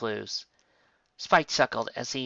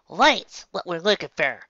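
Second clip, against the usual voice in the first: proud filly; 6 semitones higher, 7 dB louder than usual. Acted. Apple Bloom proudly finds door.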